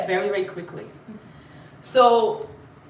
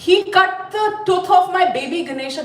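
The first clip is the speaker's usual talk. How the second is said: higher-pitched, acted indignant complaint; upset mother; 6 semitones higher, faster than usual. Mother to husband about her hurt baby, acted upset. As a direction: protest it in mock outrage. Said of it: "now Bhagavati Durga is upset".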